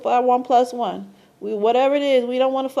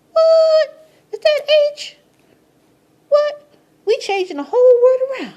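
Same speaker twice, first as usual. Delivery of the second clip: very high, loud, squealing disbelief; mock amazement; 14 semitones higher, 7 dB louder than usual. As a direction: act floored by the revelation. Mock amazed at letter change.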